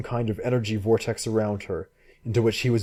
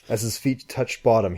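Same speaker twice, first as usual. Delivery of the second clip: ordinary delivery, nothing marked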